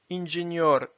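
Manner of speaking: "Ingénieur" as Russian "inzhenyor"; Russian pronunciation. Shown as a demonstration. Teacher reading words with learners, "Ingénieur" in Russian. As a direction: read "Ingénieur" in Russian, rolled R. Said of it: "s'entraîner à lire les mots".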